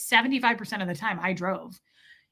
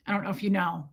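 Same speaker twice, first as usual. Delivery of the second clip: ordinary talk, nothing marked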